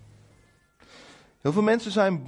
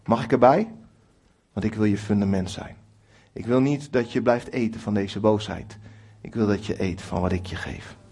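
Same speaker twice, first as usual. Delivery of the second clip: gentle inviting God; 7 semitones lower than usual. Retold or imitated. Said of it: "Ik denk dat hij zegt".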